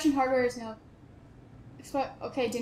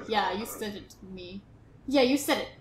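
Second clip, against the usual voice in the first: ordinary delivery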